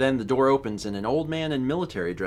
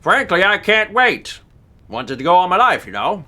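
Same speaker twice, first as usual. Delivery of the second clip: louder, higher hammed-up British chap; British accent; 11 semitones higher, 8 dB louder than usual. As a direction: play it as a jolly Englishman. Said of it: "With his accent".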